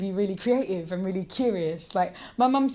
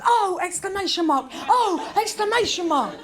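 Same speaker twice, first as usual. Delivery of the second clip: high, gushing over-enthusiasm; Walt Whitman; 10 semitones higher, 4 dB louder than usual. Retold or imitated. Comic mimicking a poet's writing, wildly enthusiastic. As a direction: exclaim it in exaggerated rapture. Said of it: "the most enthusiastic person who ever".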